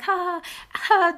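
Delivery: high, spelt-out nervous ha-ha; nervous laugh. Retold or imitated. Girl retelling a handshake with her crush, imitated nervous laugh.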